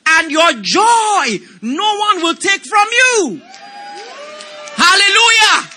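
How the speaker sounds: rising, slow, shouted scripture; preaching. Acted. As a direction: build to a full shout. Preaching scripture at full force.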